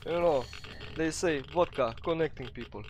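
put-on Russian-accented English. Gamer narrating his play in a put-on Russian accent. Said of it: "hard for me to do it Russian accent".

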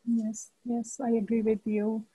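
normal talking voice

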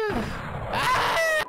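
very high-pitched shriek; frustrated scream. Real, not acted. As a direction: scream out in defeat. Fails the quiz.

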